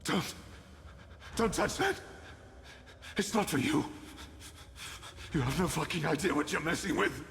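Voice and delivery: angry alarm. Acted. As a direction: shout them away from it in desperation. Desperate warning to someone taking his thing.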